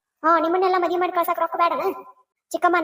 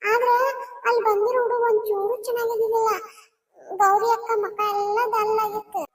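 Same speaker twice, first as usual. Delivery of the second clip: tearful; 5 semitones higher than usual. Acted. Child tells mother upsetting news.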